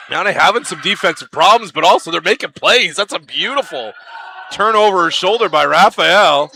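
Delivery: brisk live basketball call, rising on big plays. Acted. Commentator calling the game to fans, brisk play-by-play.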